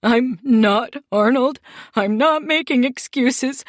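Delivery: high, loud, tearful begging; crying plea. Acted. Beaten man crying to the boss for mercy. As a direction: sob out the plea, voice cracking high. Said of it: "began to cry".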